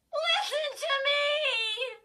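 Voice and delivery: high, loud, slow crying shriek. A narrator's reading. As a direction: shriek at the top of your lungs. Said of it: "Chris shrieked at the top of her lungs".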